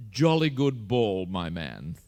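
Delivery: put-on slow, plummy English gentleman accent; infuriating plummy accent. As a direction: drawl it in a plummy accent, dropping at the end. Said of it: "in that infuriating plummy accent".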